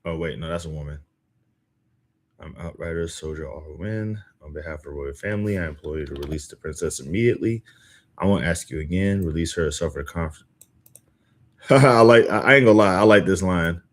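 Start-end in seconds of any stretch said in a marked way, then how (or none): 2.4-10.4 low, soft, measured in-character demand; formal soldier. Acted. Outrider demanding the princess's release, low formal voice. 11.0-13.8 loud laugh breaking into the words; laugh, then say it loud and amused. Real, not acted. Reader delighted by a favourite line, laughing loudly.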